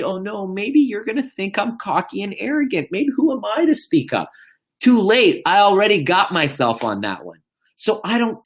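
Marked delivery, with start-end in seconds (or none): 0.0-4.3 mock-worried self-doubt, pitched high. Speaker airing doubts about seeming cocky, worried. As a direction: voice anxious second thoughts in a higher register. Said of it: "then I'm worried".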